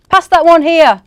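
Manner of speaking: loud, high bossy yell; shouting elves hurrying everyone. Acted. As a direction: bark orders at the workers. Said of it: "elves shouted".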